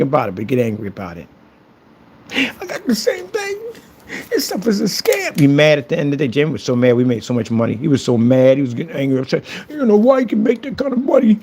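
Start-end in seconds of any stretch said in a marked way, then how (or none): 2.3-5.4 high, slow fake whimper; mock crying. Mocking troll crying over product. 9.7-11.4 mock whine. Mocking troll's question.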